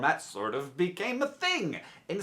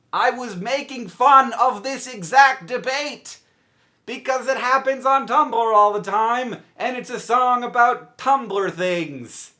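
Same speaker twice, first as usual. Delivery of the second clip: raised, higher, emphatic delivery; exasperated protest; 5 semitones higher, 5 dB louder than usual. Real, not acted. Explaining the joke to viewers, exasperated protest. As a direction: protest it loudly, hitting each point.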